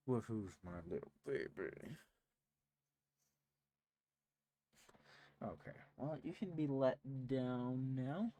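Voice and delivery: hushed, tender cooing; sweet nothings. Real, not acted. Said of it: "cooing to cat".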